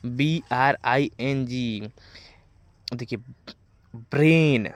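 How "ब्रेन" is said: ब्रेन with a long "ay" vowel, rhyming with rain; teacher's model ब्रेन, one drawn-out "ay" syllable; correct vowel. Shown as a demonstration. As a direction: say ब्रेन slowly as "brayn", falling at the end. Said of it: "इसको ब्रेन पढ़ना है".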